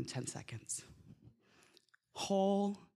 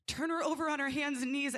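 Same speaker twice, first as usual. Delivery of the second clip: higher, pressing demand; insistent plea; 8 semitones higher than usual. Retold or imitated. Midwife repeats her plea to the nurses, higher and more insistent. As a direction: push the plea harder. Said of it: "And I am pleading".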